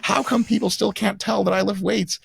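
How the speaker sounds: high, put-upon exasperation; mock fed-up complaint; exasperated. Lifter to a drunk guy, a high exasperated mock complaint.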